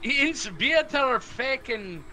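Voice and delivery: slow mock German, high; put-on German gibberish; fake German. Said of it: "your fake German sucks".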